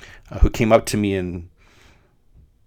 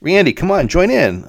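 much higher urging teacher voice; teacher nudging; 10 semitones higher, 4 dB louder than usual. Retold or imitated. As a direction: coax the boy to join in. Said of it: "elbow to the chest and says".

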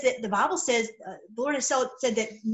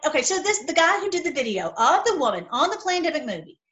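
ordinary talk, nothing marked

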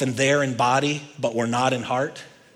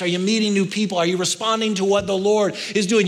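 ordinary talk, nothing marked

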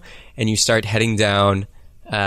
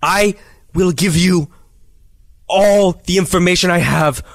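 put-on higher, eager old captive cutting a deal; bargaining prisoner voice; 6 semitones higher than usual. Prisoner offers treasure for his granddaughter's antidote, eager and pitched up. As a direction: dangle the treasure like bait, pitched up.

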